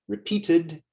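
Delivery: "Repeated" in three syllables, ending in id; sounded E. Shown as a demonstration. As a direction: say "Repeated" as re-peat-id. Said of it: "the E in the E D is not silent".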